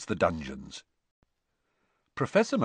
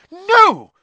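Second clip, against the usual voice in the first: very high, loud outcry; horrified cry; 13 dB louder than usual. A narrator's reading. McGonagall rushes to undo the spell, crying out. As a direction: cry out in horror. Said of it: "cried Professor McGonagall".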